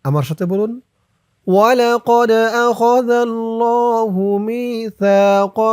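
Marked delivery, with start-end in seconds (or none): 1.5-5.6 slow, higher chanted recitation; Quran recitation. Shown as a demonstration. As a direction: intone it slowly like tajweed. Verse recitation.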